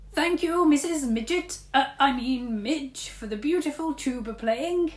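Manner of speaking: fake polite. A narrator's reading. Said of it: "lied Mister Grave".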